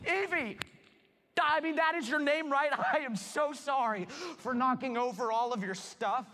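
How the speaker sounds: high, loud flustered blurting. Acted. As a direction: babble nervously at the girl. Flustered meeting, calling her.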